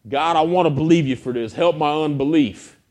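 loud, high-pitched cry to God; impassioned acted prayer; fervent plea. Preacher voices own earnest prayer.

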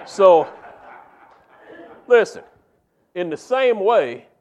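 preaching. Real, not acted. Preaching at full force.

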